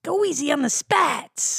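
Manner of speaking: high, slow Borscht Belt dummy voice; copied Borscht Belt impression. Host copying co-host's impression, Borscht Belt voice. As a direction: draw it out like a comic puppet. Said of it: "my impression of your impression".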